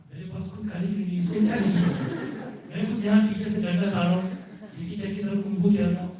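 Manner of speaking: fast, loud, exasperated retort with a laugh; exasperated rant. Retold or imitated. Answering his sister's letter, a fast, loud, exasperated retort. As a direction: rattle it off, fed up and half laughing.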